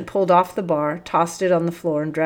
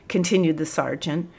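ordinary delivery, nothing marked

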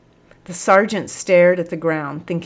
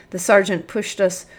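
plain reading voice

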